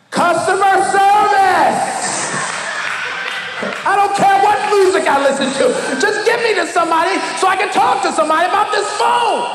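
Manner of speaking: high, loud exasperated yelling; fed-up shout. Retold or imitated. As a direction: shout at the menu to get a human. Yelling at the phone menu.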